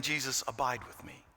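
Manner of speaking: hushed prayer. Retold or imitated. Soft quoted prayer.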